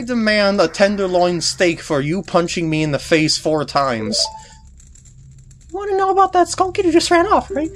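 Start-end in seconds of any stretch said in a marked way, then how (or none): 5.7-7.7 high, indignant fairy scolding; bossy fairy. Acted. Tatl blaming Deku boy for losing her brother, high and indignant. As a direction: snap at him like a bratty little fairy.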